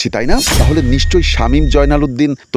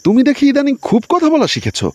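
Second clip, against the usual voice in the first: much higher, sharp scolding wife voice; angry glare. A narrator's reading. Wife scolding her husband for talking back, high and sharp. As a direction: snap at him with a high, cutting voice. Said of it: "এবার চোখ গরম করে আমাকে বলল".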